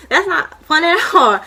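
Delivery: high, loud laugh breaking the words; amused laugh at the thought of burning forever. Real, not acted.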